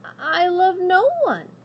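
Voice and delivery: high, loud, slow and haughty; proud princess. Acted. Princess proudly refusing the witch. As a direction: declare it proudly. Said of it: "said the princess proudly".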